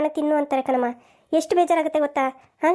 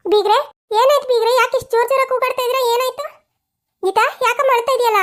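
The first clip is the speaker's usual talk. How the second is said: higher, worried voice of the visiting mother; a mother who walks in on a quarrel, pitched higher; concerned visiting mother; 6 semitones higher than usual. Acted. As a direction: ask anxiously what all the shouting is about. Visiting in-law asks about shouting.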